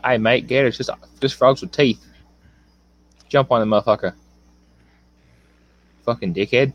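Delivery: lightly voiced Australian accent; a hint of Aussie bloke, casual; imitating Hyper's Australian accent, understated. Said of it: "That's how he would say it".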